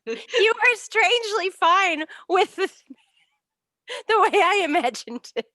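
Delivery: high, loud, laughing through words; giggly, high-pitched teasing; cracking up. Real, not acted. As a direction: struggle to speak through real laughter. Cracking up over cat image.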